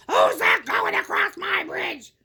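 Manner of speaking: slow, shouting troll voice; great terrible troll. A narrator's reading. Troll shouting at whoever crosses his bridge, great terrible voice. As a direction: challenge the trespasser as a terrible troll. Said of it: "the troll began shouting at him in his great, terrible voice".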